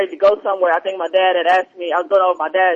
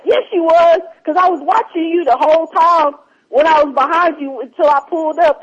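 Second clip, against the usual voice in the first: higher, pushy insistence; insistent stranger; 9 semitones higher than usual. Retold or imitated. Stranger in the truck insisting she was on her phone, higher and pushy. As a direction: insist you saw it all, voice raised up high.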